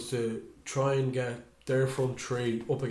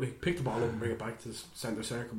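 usual speaking voice

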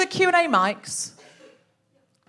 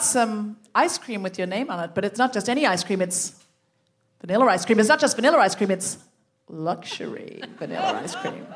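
mock seductive; 6 semitones lower than usual. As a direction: tempt him with ice cream, hammed-up. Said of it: "protest the sexual nature of that delivery".

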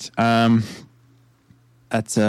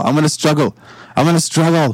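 higher, insistent repeated protest; struggling protest; 4 semitones higher than usual. Acted. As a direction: insist more urgently each time. Player resists being carried off.

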